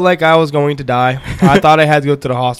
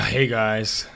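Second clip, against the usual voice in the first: groggy hungover greeting; low, tired hungover voice; 4 semitones lower, 11 dB quieter than usual. Real, not acted. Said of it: "He's still hungover".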